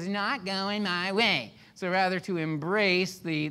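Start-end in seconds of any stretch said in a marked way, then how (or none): 0.0-1.5 higher, put-on whiny complaint; mock whining. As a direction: whine like a thwarted kid. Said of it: "I'm whining through it".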